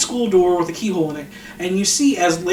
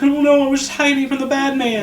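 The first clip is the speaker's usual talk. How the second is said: higher-pitched imitation of a panicked girl; mock panic, pitched up; 6 semitones higher than usual. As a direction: play a scared kid making excuses. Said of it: "she's just panicked".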